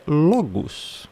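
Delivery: "logos" slowly, as LO-gus; careful, slow "logos" ending -gus; correct Greek sound. Shown as a demonstration. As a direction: say "logos" softly with one u vowel at the end. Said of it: "é logos".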